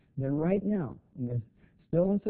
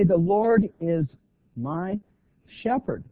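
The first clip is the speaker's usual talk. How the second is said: slow, loud, pausing between Lord is, my and shepherd; loud preacher's emphasis on my; stress on my; 3 semitones higher, 6 dB louder, slower than usual. Shown as a demonstration. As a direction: let my and shepherd land apart, spaced for weight. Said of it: "so we can say".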